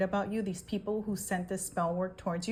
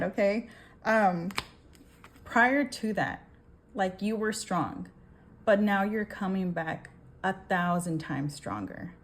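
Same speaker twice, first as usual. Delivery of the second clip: ordinary delivery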